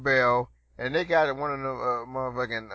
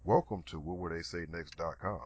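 lower, softer announcer read; deep voiceover; 7 semitones lower, 5 dB quieter than usual. Shown as a demonstration. Host demoing own podcast voiceover, deep and low. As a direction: intone it like a radio promo. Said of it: "I could probably do my own voiceovers".

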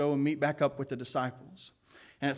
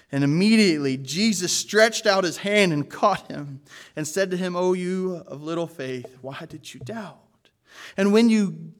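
raised, earnest reading of scripture; 5 dB louder than usual. Acted. Preacher reading Matthew fourteen to the church, earnest. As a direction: read the passage out to the congregation.

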